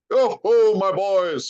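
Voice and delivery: high, loud, booming jolly call; a merry master rallying his lads; jovial Fezziwig. A narrator's reading. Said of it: "said Fezziwig".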